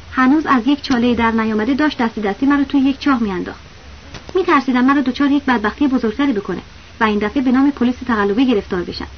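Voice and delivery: ordinary delivery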